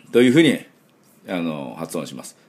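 normal talking voice

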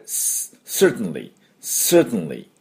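"certainly" with a hissed S, then "sert-nly"; model pronunciation. Shown as a demonstration. Teacher demonstrating the sound, "certainly" as "sert-nly". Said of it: "ていう発音です".

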